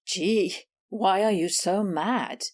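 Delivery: lightly voiced Miss Maggie shrugging it off; mocking rival cat.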